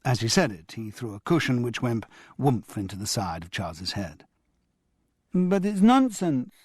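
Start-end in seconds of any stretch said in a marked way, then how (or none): 5.3-6.5 put-on high, slow protesting little boy; a small boy pleading it makes no sense; child objecting. A narrator's reading. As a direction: Damien objects to the nonsense word, high and slow. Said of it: "objected Damien".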